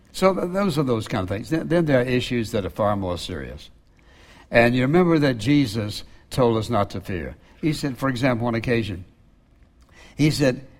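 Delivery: normal talking voice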